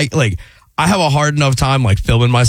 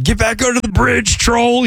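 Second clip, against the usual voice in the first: high-pitched mock yell; imitated drunk heckler; 10 semitones higher than usual. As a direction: heckle like a drunk from the back of the room. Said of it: "drunk guy in the back of the room yell at me".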